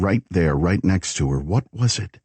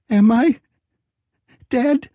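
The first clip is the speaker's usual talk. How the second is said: very high, louder frightened question; terrified; 3 dB louder than usual. A narrator's reading. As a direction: ask it as if the answer could break her. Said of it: "terrified her so deeply".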